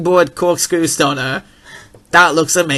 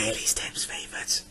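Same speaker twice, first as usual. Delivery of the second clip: very soft whisper; whispered aside; 6 semitones lower, 16 dB quieter than usual. Pretend. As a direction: whisper it like a confided secret. Whispered aside about co-host.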